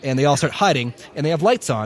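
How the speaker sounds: ordinary delivery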